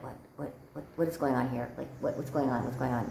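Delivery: low, hushed, stammered bewilderment; stunned questioning. Retold or imitated. Woman just standing there after his refusal, stunned and hushed. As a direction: murmur it under your breath, confused. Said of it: "I'm just standing there like".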